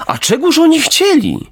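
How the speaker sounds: very high, theatrical gasp of a question; mock-shocked fox. Fox faking concern about the robbers, very high. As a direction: pretend to be appalled.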